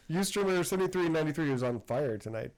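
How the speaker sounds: normal talking voice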